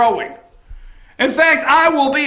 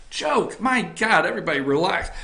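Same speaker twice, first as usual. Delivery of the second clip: quiet backpedal; 7 semitones lower, 6 dB quieter than usual. Real, not acted. Backing off his own joke.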